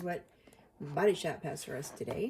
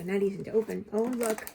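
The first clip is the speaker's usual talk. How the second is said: ordinary talk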